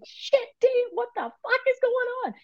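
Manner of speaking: very high, alarmed squeal; yelping in shock at what's happening; freaked-out outburst. Retold or imitated. Asking her guides what is going on, in a very high alarmed squeal. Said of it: "I'm saying".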